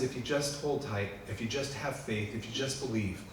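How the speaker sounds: God tenderly promising; God reassuring. Retold or imitated. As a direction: coax them to hold on. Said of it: "God says".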